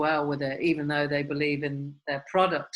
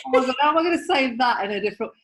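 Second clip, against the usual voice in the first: excited self-quote; 7 semitones higher, 6 dB louder, faster than usual. Retold or imitated. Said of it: "I'm like".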